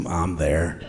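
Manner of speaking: quoting himself, dropped low and soft; lightly underplayed, low quiet quote; low aside. Comic recalls deciding on the strip club, low quiet aside. Retelling his eager decision at club.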